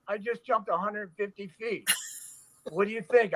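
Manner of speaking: high-pitched, worked-up comeback; mock incredulous retort. Stuntman answering onlookers after the fall, mock incredulous.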